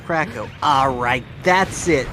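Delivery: ordinary talk, nothing marked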